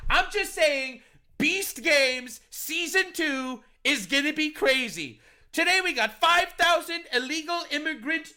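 high-pitched mock show hype; hammed-up contest intro, pitched high; game show host. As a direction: announce it like an over-the-top game show host. Hypes imagined game show like host.

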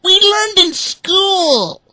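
very high, loud, slow duckling voice; quacking ducklings. A narrator's reading. Ducklings siding with their brother, high. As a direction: back up your brother smugly. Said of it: "Up quacked the other ducklings".

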